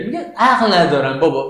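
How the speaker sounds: ordinary talk, nothing marked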